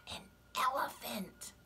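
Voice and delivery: hushed storyteller whisper. A narrator's reading. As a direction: breathe the name for suspense. Suspense before new animal.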